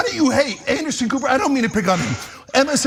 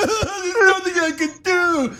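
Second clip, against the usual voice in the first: high, slow, laughing whine; hammed-up helpless politician; whiny mock Biden; 8 semitones higher than usual.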